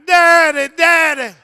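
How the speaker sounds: high, loud wailing call; imitated crying daughter. Toddler leans on playpen rails to Daddy, imitated crying. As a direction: cry out like a pleading toddler. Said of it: "she lean on the rails crying".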